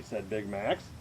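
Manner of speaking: usual speaking voice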